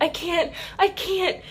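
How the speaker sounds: high-pitched retold plea; desperate protest. Labouring mother answering her aunt, desperate imitated protest.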